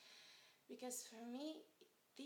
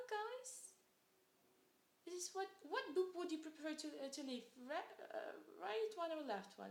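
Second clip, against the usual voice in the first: put-on flustered child answering; 4 semitones higher, 3 dB louder than usual.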